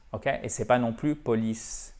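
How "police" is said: "police" flat, as French po-lis; "police" with a short French i; flat French pronunciation. Shown as a demonstration. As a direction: say "police" evenly, with no English stress. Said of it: "C'est pas plat comme en français".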